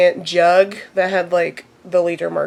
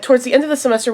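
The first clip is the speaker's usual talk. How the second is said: ordinary delivery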